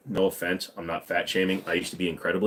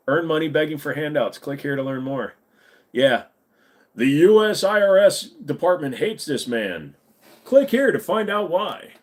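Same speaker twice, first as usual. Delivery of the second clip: clickbait ad voice; 6 semitones higher, 5 dB louder than usual. Pretend. Mock clickbait ad read.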